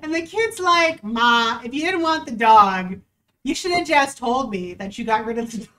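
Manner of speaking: ordinary talk, nothing marked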